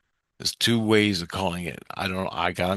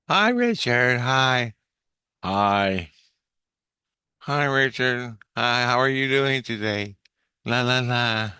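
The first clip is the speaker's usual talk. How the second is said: put-on higher sing-song girl voice; girl in his head; 4 semitones higher, slower than usual. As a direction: mimic a girl chirping hello. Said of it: "she says".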